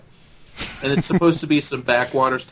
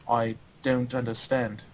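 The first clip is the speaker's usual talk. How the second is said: put-on slow, softer, humourless European accent; stern European accent; 2 semitones lower, 6 dB quieter, slower than usual. Coach imitating a humourless European after a joke, accented. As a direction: imitate a stiff European, word by word. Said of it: "That's a pretty good accent".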